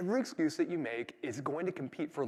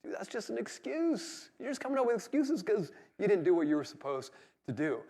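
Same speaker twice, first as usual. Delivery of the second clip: higher, exasperated callout, slowing on excuse; eye-rolling retort; 5 semitones higher than usual. Retold or imitated. To a coworker at a work meeting, eye-rolling callout. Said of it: "rolling your eyes going".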